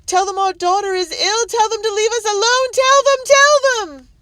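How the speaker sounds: wife losing control, frantic shrill screaming; building to hysteria. A narrator's reading. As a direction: panic spiralling out of control. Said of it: "voice was rising to a shrill pitch".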